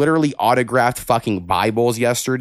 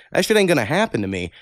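normal talking voice

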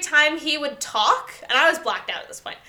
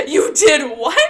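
louder laughing 'what?' with a rise; laughing disbelief; 6 dB louder, slower than usual. Retold or imitated. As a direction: replay the shock while laughing. Re-enacting her own shocked reaction.

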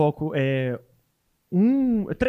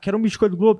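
lightly put-on Rio accent, higher and louder; imitated carioca accent; 5 semitones higher, 5 dB louder, faster than usual. Mineiro guest shows host a local ordering, imitated carioca accent. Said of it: "se você pedir no sotaque".

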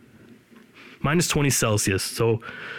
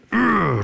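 mock angry growl; 9 semitones higher, 3 dB louder than usual. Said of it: "don't I sound mad?".

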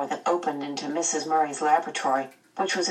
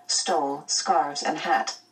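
plain reading voice

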